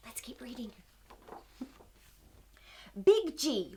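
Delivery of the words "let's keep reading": soft conspiratorial whisper. Acted. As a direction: hush it like a secret. Conspiratorial aside to children.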